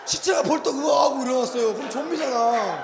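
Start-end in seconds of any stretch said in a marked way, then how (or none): 0.1-1.7 loud hammed-up groan as the corpse sits up; big comic zombie rising; mock zombie groan. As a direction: act the corpse lurching up with a shout.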